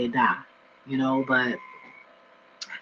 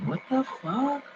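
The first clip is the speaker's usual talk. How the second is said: much higher, slow stretched outrage; stunned what the fuck; 10 semitones higher, 4 dB quieter, slower than usual. Retold or imitated. Seeing the news, high slow stunned outrage. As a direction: let it hang in total disbelief. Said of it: "I'm like".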